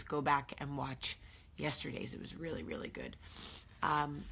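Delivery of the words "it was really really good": a sudden deep, fast aside voice; mock low voice. Praising yesterday's video in a mock deep aside voice. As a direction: drop into a gruff joke voice.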